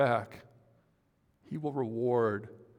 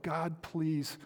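rising, ever louder desperate plea, higher pitch; pleading cry; 6 semitones higher, 8 dB louder than usual. Retold or imitated. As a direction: start as a pleading prayer and build to a loud cry. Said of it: "You're crying out".

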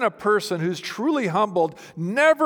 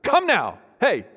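higher and louder call to attention; "Come now" called out higher and louder like a wake-up shout; attention grabber; 5 semitones higher, 4 dB louder, slower than usual. Shown as a demonstration. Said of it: "It's like an attention grabber".

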